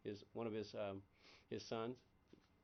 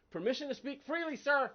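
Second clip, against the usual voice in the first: louder, higher, formal soldier asking a superior; mock military address; 10 semitones higher, 9 dB louder than usual. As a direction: request leave to speak, stiffly. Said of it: "addressing a superior officer".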